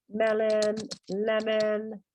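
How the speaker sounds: "Melon, lemon" drawn out, both ending in "en"; pronunciation examples. Shown as a demonstration. Teacher giving examples of the rule, "Melon, lemon" with held "-en". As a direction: stretch "Melon, lemon" evenly with clear "-en" endings. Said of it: "se pronuncia".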